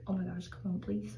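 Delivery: usual speaking voice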